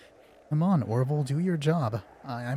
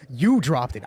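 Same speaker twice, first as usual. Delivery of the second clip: mock accusing player; 8 dB louder than usual. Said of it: "I was acting as you".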